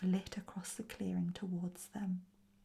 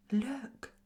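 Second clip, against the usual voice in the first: higher, louder alarmed call; crying out; 5 semitones higher, 6 dB louder than usual. A narrator's reading. Kirsty stops the others in their tracks, crying out. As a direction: cry out to halt everyone. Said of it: "she cried".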